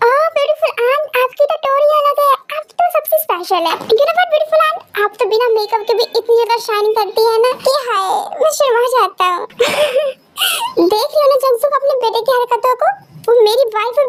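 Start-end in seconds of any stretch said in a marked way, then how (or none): none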